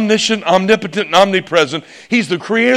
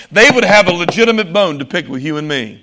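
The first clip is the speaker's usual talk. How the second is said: ordinary delivery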